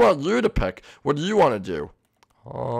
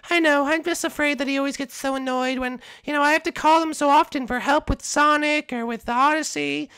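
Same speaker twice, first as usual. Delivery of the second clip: lifted, sing-song princess and toad voices; high princess voice; 10 semitones higher than usual. Acted. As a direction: play a fussy princess gossiping with her toad guard, pitched up high. Princess and toad chatting, puppet voices.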